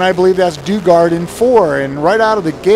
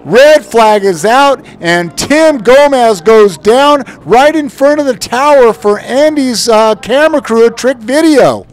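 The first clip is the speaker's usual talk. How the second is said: excited; 5 semitones higher, 6 dB louder than usual. Real, not acted. Reporting the crash right after.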